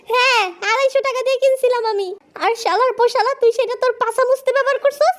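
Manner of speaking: high-pitched indignant rant; acted squawking indignation; comic outrage. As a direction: rail at your friend in a high, appalled voice. Outraged over ruined face mask.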